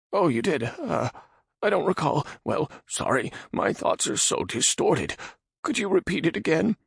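high nervous man voice; frightened Radagon. Acted. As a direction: ask in timid fear. Radagon timid.

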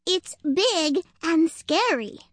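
high, slow frightened voice; anxious, high-pitched squirrel, drawn out; panicked squirrel. A narrator's reading. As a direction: spell out the danger in alarm. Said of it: "out of breath and in a panic".

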